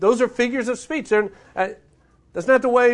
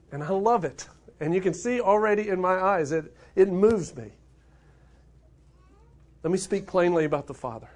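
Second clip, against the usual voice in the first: ordinary delivery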